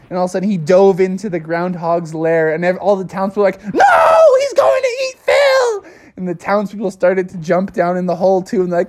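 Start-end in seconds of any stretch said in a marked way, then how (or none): 3.7-5.8 very high, louder crying shriek; terrified scream. Acted. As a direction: shriek in horror for Phil. Townspeople fear for Phil.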